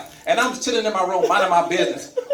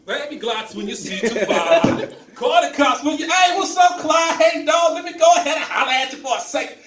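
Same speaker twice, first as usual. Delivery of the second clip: hammed-up hip doctor, higher and shouted; mock hip-hop doctor; 7 semitones higher than usual. Young doctor rapping [name] to the patient, hammed-up. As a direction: play a square trying to be cool. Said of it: "dancing to [name]".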